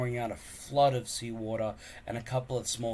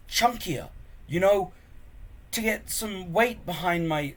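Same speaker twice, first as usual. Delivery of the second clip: hopeful; 8 semitones higher, 6 dB louder than usual. Acted. Hiccup hopeful about swords.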